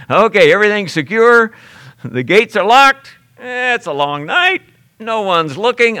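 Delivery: a hammed-up sleepy jailer settling in; lazy jailer.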